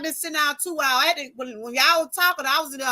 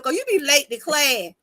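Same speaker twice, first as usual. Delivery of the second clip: normal talking voice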